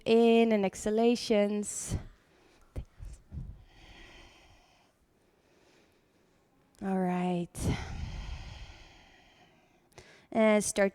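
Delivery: calm voice easing the class into breath; guided meditation. Acted. Leads seated breathing meditation.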